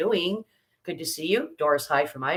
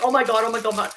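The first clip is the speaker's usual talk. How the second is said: re-enacted excited gushing, pitched up; crazy excited; 5 semitones higher, 3 dB louder, faster than usual. Retelling to viewers her reaction to her daughter, crazy excited. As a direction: gush it higher, repeating in a rush of excitement. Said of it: "I went crazy".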